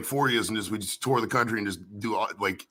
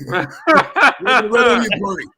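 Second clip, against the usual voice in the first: laughing; 10 semitones higher, 9 dB louder than usual. Real, not acted. Laughing at onion bit.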